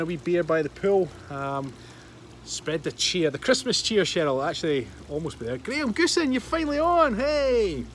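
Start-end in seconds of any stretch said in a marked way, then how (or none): 5.6-7.8 high-pitched, delighted call-out; excited greeting. Real, not acted. Host greets a late-joining viewer, real excited high shout-out. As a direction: hail a friend who has just turned up.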